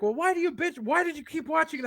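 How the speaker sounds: lightly mocked friend asking why he complains; mocking challenge. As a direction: mimic a nagging question. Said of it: "so when it's like".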